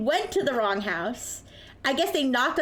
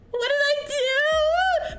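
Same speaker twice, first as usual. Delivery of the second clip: high, drawn-out mock wail; mock dismay; 13 semitones higher, much slower than usual. Storyteller replaying her reply to the phone yelling, mock dismayed wail. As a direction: stretch it into a high, exaggerated plea. Acts out own alarmed reply.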